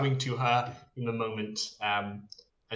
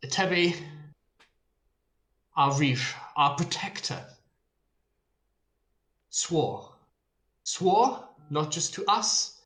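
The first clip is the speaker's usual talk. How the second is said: herald's proclamation with long pauses and raised pitch; solemn herald; 4 semitones higher than usual. Acted. Herald addressing a throng over the body, solemn proclamation. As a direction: proclaim it grandly, phrase by phrase. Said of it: "continues to say".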